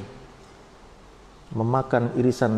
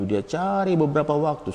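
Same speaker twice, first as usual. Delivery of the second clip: ordinary talk, nothing marked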